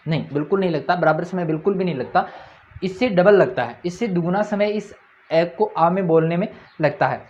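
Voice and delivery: ordinary talk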